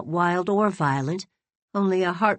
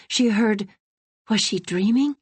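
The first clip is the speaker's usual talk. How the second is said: ordinary delivery, nothing marked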